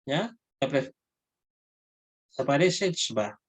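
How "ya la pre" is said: ordinary talk, nothing marked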